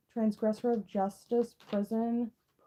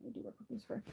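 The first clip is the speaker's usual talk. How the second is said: much softer repeat of "I do work with Lucifer"; softer quiet repeat; 2 semitones higher, 15 dB quieter, much faster than usual. Real, not acted. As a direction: drop the repeat to near a murmur. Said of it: "repeated quieter".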